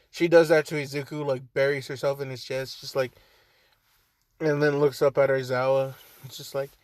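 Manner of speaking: ordinary delivery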